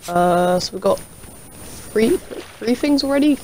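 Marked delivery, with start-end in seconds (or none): none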